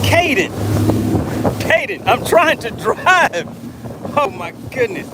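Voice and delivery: high, pleading yells; exasperated yelling. Real, not acted. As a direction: stop him while driving, half laughing. Caden keeps sending while he drives.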